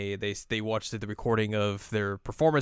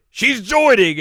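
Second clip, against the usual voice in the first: high, loud proclamation; forceful announcement; 12 semitones higher, 12 dB louder, slower than usual. Retold or imitated. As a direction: shout it triumphantly in character.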